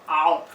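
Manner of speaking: sharp yelp of pain; a real ow as the fingers burn; burnt fingertips. Real, not acted. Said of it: "I burned my finger".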